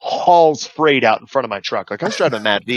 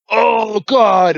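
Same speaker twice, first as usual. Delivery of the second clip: high, drawn-out mock dismay; retold horror; 8 semitones higher, 4 dB louder than usual. Driver watching the crowd beat him, mock horror. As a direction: groan loudly in mock horror.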